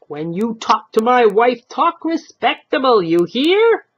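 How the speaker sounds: loud, high scolding; angry warning. Acted. Old husband warning the stranger about his wife, angry. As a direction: lay down the law.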